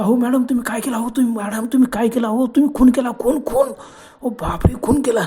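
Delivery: high, loud panicked cry; panicked alarm. Acted. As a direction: blurt it out in terror, voice climbing on खून. Young man panics over the murder. (घाबरून उंच आवाजात ओरडणे)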